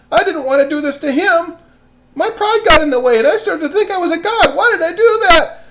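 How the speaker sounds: high, loud, wailing and anguished; a king wringing his hands in hammed-up despair; distraught king. King regrets his rule and wails high and loud. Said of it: "so distraught and upset and sad".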